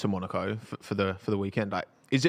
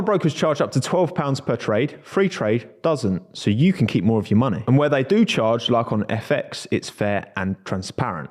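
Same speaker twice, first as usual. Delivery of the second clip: upbeat sponsor read, a bit louder; 6 dB louder than usual. Acted. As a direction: sell it like a podcast ad. Reads sponsor ad.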